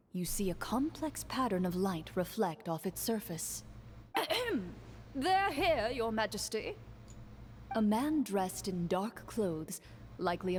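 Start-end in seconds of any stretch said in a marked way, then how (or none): 3.7-5.1 high, soft throat clearing. Acted. Minister announces the guests. 5.2-6.8 minister presents the guests to the king; announcing minister. Acted.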